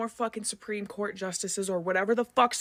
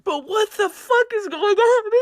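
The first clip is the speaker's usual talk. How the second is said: higher, louder, slow exasperated cry; emotional outburst; 10 semitones higher, 7 dB louder than usual. Real, not acted. Vlogger venting about the election, emotional and exasperated. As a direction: let the frustration burst out. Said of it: "Forgive me for being a little emotional".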